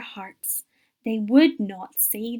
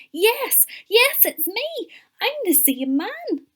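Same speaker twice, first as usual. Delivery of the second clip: much higher, eager insistence; excited; 12 semitones higher, slower than usual. A narrator's reading. Healed man to doubting neighbours, excitedly. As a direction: burst out with it, pitched high. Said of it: "the man said excitedly".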